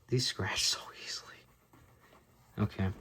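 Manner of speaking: hushed whispered aside. Real, not acted. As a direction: breathe it out as a whisper. Aside while handling card.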